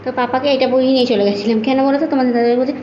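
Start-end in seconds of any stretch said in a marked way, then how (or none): none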